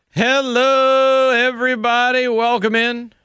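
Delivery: high, long-held radio greeting; drawn-out, high-pitched host's welcome; big show opener. Real, not acted. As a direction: sing out the welcome on one high note.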